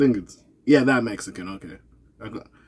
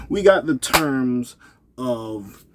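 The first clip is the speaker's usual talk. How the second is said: ordinary talk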